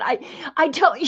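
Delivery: high-pitched mock exasperation; imitated frustration. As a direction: lightly act out an exasperated teacher. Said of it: "she'd get so frustrated with me".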